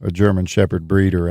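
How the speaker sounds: usual speaking voice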